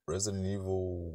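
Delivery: usual speaking voice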